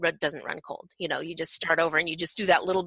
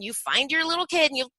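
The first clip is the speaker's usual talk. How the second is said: ordinary delivery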